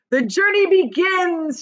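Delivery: high, loud, slow proclamation; shouting. A narrator's reading. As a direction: announce it to all. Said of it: "shouted the centipede".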